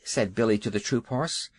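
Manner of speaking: ordinary delivery, nothing marked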